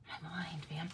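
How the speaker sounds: whispered aside in disbelief; whispered "My mind, man" to herself. Real, not acted. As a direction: hush it, fully unvoiced. Amazed at her own naming.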